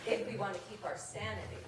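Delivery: deadpan mock-formal letter read in verse; reading a mock rejection letter poem over audience laughter; comic poem reading. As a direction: read it like a snooty editor's form letter.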